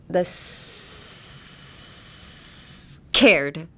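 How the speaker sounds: long hissed s released into a punched CARED; dam-burst K. Shown as a demonstration. Teacher demonstrating the dam release, hissed s then hard k. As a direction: hold back, then burst open on the K of cared. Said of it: "Emphasis on the K".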